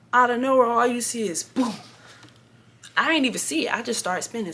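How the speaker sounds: loud, high burst as the crash hits; dramatic crash retelling. Retold or imitated. Telling viewers about the crash, loud and high. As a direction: relive the shock of the impact out loud.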